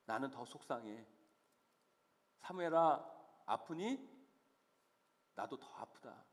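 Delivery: hushed, sinking into low sympathy; tender God consoling. Acted. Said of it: "사무엘에게 이렇게 말씀하시고 계시는 거예요".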